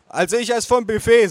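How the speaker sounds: mother stating choice. A narrator's reading. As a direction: announce it as the mother. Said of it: "sagte meine Mutter".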